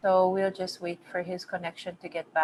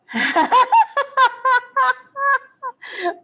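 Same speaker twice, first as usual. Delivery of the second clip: loud high laugh; real laugh, up high; high burst of laughter; 9 dB louder than usual. Real, not acted. Laughs again at comment.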